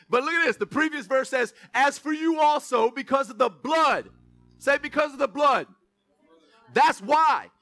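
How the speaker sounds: loud, punchy preacher cadence on "blood"; preaching at full force. Acted. Pastor calling the congregation to repeat the verse, loud preaching. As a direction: thunder it from the pulpit.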